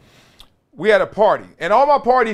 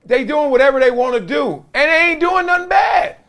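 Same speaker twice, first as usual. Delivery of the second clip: excited shout; 10 semitones higher than usual. Real, not acted. Host marveling at kids playing freely, excited shout.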